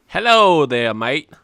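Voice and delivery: loud, botched posh British hello; a failed fancy British accent greeting; fancy accent attempt. Greeting the YouTubers in a botched fancy accent. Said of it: "I was trying to do a, a fancy accent".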